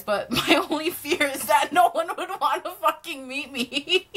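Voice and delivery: can't stop laughing at the thought; laughing hard. Real, not acted. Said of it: "I don't know why I'm laughing so hard".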